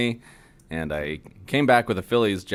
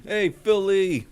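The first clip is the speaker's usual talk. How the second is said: higher, slow stretched fan call; Philly greeting; 11 semitones higher, slower than usual. Pretend. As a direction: call it out again, even higher.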